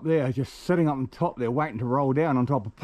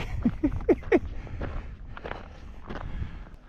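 breathy high laugh; 6 dB quieter than usual. Real, not acted. Laughs at clothes rack.

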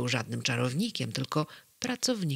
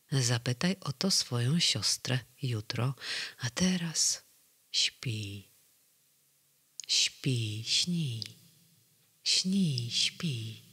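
hushed near-whisper, fading into a sleepy lullaby; lowered voice; 2 semitones lower, much slower than usual. A narrator's reading. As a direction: lull him to sleep in a whisper. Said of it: "Pan Sen ściszył głos". (ściszonym, usypiającym szeptem)

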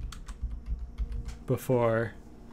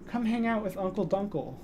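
soft cat coaxing; 6 semitones higher, 7 dB quieter than usual. Real, not acted. Coaxing cat during stream.

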